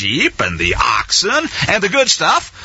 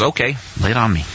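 resigned; 9 semitones lower, 3 dB quieter, faster than usual. Acted. Saul resigns himself.

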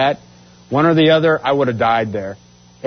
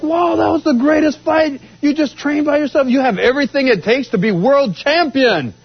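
much higher, excited praise; amazed instructor; 9 semitones higher than usual. Retold or imitated. Instructor gushing to his fighter after the win, high excited voice. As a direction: gush over the fight like a proud coach. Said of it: "And he's like".

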